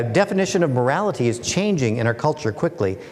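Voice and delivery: ordinary delivery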